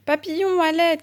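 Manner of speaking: high, loud call to a friend; crying out. A narrator's reading. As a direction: shout the name across the distance. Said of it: "crie-t-il".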